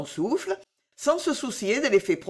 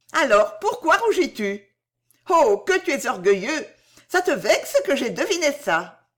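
higher, livelier teasing voice; Bijou taquine; 6 semitones higher, 4 dB louder than usual. Acted. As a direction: tease him, pitch lifted. Bijou teases Jean. (voix de Bijou, aiguë et taquine)

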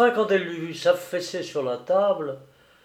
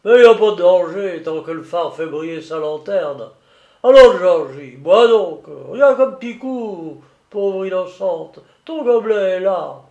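loud, higher jovial voice; tipsy, coaxing keeper; 3 semitones higher, 9 dB louder than usual. A narrator's reading. Keeper urging the girl to drink, tipsily coaxing. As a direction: coax like a merry drinker.

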